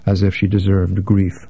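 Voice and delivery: ordinary delivery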